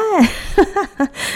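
long high laugh at the absurd twist; giggling fall; amused laugh. Real, not acted. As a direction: laugh at how funny it sounds. Husband was her father before.

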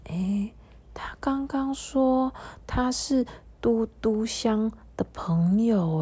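soft unvoiced whisper, slowed; whispered to herself. Dudu Xiang whispers to herself about the new friend, hushed whisper. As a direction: whisper it to yourself in wonder. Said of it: "然后他低声 自言自语的说".

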